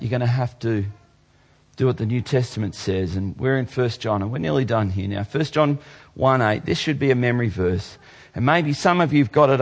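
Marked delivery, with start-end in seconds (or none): none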